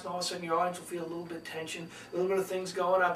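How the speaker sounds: quick, hushed build of tension; soft and fast. Shown as a demonstration. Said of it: "You talk soft and fast".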